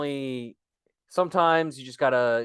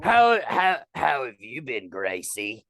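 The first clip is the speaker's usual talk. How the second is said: stammering fake Cockney, dropping low; put-on Cockney small talk; 3 semitones lower, 3 dB louder than usual. Guest asking [name] how she has been, mock Cockney. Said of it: "Cockney accent".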